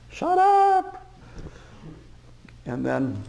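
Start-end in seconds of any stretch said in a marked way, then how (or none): 0.1-1.0 loud, very high-pitched yell; one sudden yell to get attention; wrathful shout. Retold or imitated. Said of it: "it was just".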